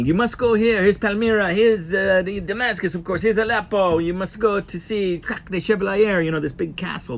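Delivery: high-pitched imitation of a Syrian official's accent; imitated Syrian accent. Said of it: "and telling me".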